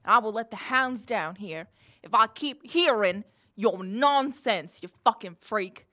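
scornful jailer laying down threats; threatening jailer. Acted. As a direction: lean hard on the insults.

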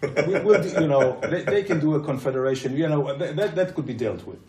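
loud laughing reply. Real, not acted. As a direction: brush it off with a laugh. Laughing loud reply about king.

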